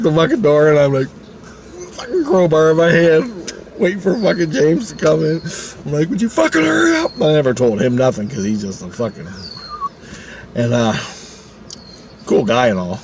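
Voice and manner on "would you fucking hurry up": very high, urgent plea; retold squeal of nervous impatience; frantic impatience. Waiting with a crowbar for James, frantic impatience.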